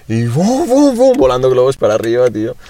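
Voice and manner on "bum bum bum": high, rising "bum" sound effects; playful boom noises, climbing in pitch; imitated booms. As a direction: voice the balloons bursting upward. Imitating balloons flying.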